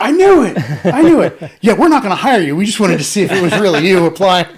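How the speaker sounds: high, loud acted crowing; gloating outburst. Interviewing executive gloating at the disgraced applicant, loud mock triumph. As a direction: shout it in mock triumph at catching him out.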